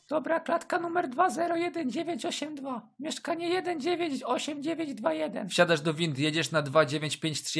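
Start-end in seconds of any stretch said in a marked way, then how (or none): 0.1-5.5 high-pitched woman on intercom. Acted. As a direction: give directions like a young woman.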